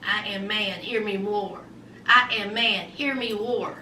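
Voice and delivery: low, soft mock caveman voice; big bad caveman. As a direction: play a pompous caveman, low and quiet. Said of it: "Here comes big bad Usman".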